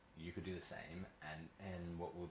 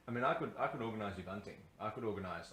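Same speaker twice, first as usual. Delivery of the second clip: eager; 3 semitones higher, 7 dB louder than usual. Acted. Eager fast loud offer.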